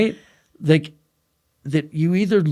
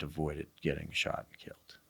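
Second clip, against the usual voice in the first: hushed, shaken whisper; awed whisper reliving the moment; 9 semitones lower, 17 dB quieter than usual. Real, not acted. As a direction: drop to a whisper as it sinks in. Recounting near-death realization.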